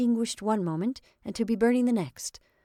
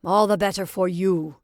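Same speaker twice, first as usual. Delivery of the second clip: louder, bitter, spat out; in a rage; 7 dB louder than usual. A narrator's reading. Paul, rejected, answering his friend in a loud rage. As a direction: snap back at the winner. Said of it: "he replied in a rage".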